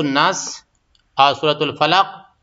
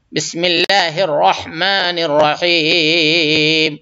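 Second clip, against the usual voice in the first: slow, drawn-out tajweed chant; Quran recitation; much slower than usual. Shown as a demonstration. Teacher reciting for students, slow tajweed chant. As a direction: recite it slowly and melodically.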